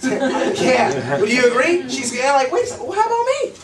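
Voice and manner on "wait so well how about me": high-pitched mock protest; eager imitation. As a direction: squeal it as a left-out prospect. Acts out the eager prospect.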